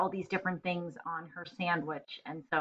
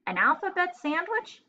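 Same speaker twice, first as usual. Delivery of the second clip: surprised disbelief; 10 semitones higher than usual. Acted. McDougal just stared.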